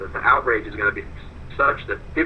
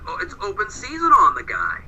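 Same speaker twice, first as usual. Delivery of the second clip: imitating Marcus; 8 semitones higher, 3 dB louder than usual. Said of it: "Marcus is like".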